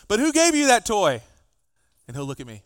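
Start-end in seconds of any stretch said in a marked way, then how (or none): 0.1-1.2 louder, higher pointed question; pointed question to son. Retold or imitated. Father to son over a toy, louder and higher. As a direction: press the child on who gave the toy. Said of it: "What I tell my son is".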